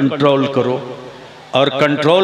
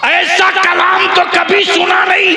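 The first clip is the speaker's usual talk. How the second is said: high, loud impassioned storytelling; preaching at full force; 3 dB louder than usual. Acted. Preacher thundering to the gathering, impassioned. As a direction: drive the story forward to the crowd.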